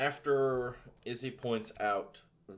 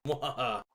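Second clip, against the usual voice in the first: mad scientist; 5 semitones higher, 6 dB louder than usual. Acted. GM gloats at a bad roll.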